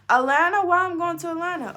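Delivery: loud, high, fast shocked cry; shocked outcry. Retold or imitated. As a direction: yell it in disbelief. Shocked at being sent away.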